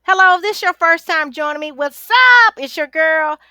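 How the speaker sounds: fast, loud, bright hello to viewers; excited greeting. Real, not acted. Coach greeting her viewers, loud and fast. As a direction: burst in with energy.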